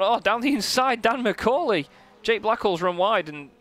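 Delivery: high, loud overtaking call; excited. Real, not acted. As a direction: shout the move as it happens. Overtake down the inside.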